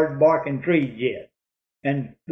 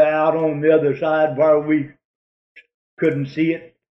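usual speaking voice

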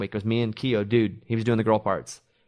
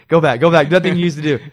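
loud playful coaching shout from the sidelines; shouting instructions across the room; mock yell; 4 semitones higher, 10 dB louder, faster than usual. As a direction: yell it like a coach egging him on.

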